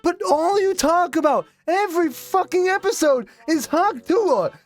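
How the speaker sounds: high-pitched, worked-up tirade; hammed-up losing-it outburst; exasperated rant. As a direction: rant up high, stretching out "move on". Acted exasperated rant at the podcast.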